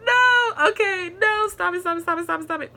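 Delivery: high, tight pleading to stop crying; fighting back tears. Real, not acted. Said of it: "about to tear up".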